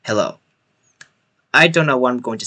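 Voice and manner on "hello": "Hello" lower and softer, tossed off; the careless hello a teacher wouldn't use; quiet, offhand "Hello"; not like a teacher. Shown as a demonstration. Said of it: "and it's not like".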